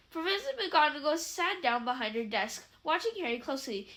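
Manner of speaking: usual speaking voice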